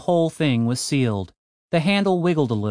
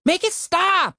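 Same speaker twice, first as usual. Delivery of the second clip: high yell; slower than usual. Acted. Alarm blaring, desperate yelling.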